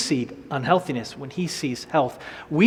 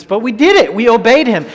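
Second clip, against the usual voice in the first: full-force preaching; 6 semitones higher, 9 dB louder than usual. Acted. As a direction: drive the command home to the church. Preaching climax on obedience.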